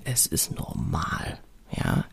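soft reassuring whisper. Real, not acted. Reassuring a stressed mother in a soft slow whisper. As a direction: lean in and whisper it gently. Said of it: "Ich möchte dich da ermutigen".

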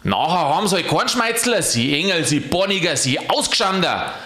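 high, quick volley of insults; indignant scolding. A narrator's reading. Angel abuses the silent saint.

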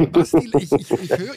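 laughing. Real, not acted. Laughing while being laughed at.

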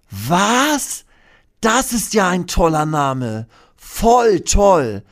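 hohe, laute Dinostimme voller Freude; begeisterter Dino. Acted. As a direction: quiek es begeistert heraus wie ein kleiner Dino. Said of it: "denkt Hermann und klatscht". (hohe, aufgeregte Dinostimme)

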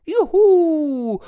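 high, loud whoop; joyful shout. Acted. Foresters cheering the bear, high loud whoop. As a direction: cheer like a crowd. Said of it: "riefen die Förster".

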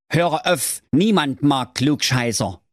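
mild high grumbling dismissal; Wilhelm's voice. Acted. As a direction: brush him off like a grumpy elder.

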